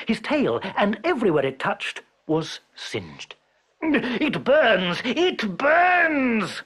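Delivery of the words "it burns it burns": high pained yell from the tiger. Acted. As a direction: cry out as the fur singes. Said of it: "yelled Tiger".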